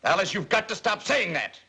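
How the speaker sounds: father yelling at his lying daughter; angry shout. Acted. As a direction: shout her down.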